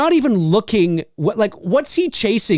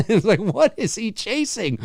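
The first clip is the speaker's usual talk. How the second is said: ordinary talk, nothing marked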